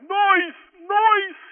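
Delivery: high, loud Brooklyn cry for the nurse; Bert Lahr Brooklyn accent. Retold or imitated. Bad comedian imitating a comic calling for the nurse, Brooklyn accent. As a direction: call out "noice" in a squealing-high Brooklyn accent. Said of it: "in this Brooklyn accent, and he sounds like Bert Lahr".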